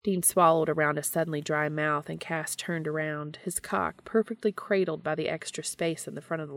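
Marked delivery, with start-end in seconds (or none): none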